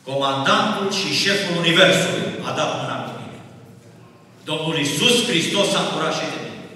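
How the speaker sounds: loud preacher's testimony, swelling on key words; impassioned preaching. Real, not acted. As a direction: proclaim it to the whole congregation. Preaches at full force.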